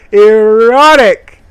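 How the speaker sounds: loud high drawn-out erotic; mock-dramatic proclamation; hammed-up shout. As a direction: bellow it like a game announcer.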